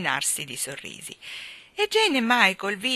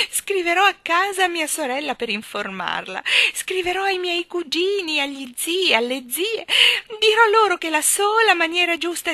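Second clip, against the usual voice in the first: high, loud elation with drawn-out words; giddy trilling; 10 semitones higher, 8 dB louder than usual. A narrator's reading. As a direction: gush ecstatically as if whirling in the air. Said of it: "trillò piroettando nell'aria". (euforia acuta e trillante)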